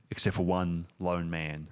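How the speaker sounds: usual speaking voice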